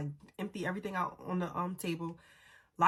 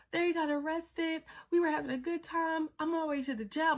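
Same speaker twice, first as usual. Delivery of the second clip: high, frantic retelling of the phone call; panicked call to mom; 9 semitones higher than usual. Retold or imitated. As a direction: sound like a scared daughter telling mom the news. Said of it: "in panic mode".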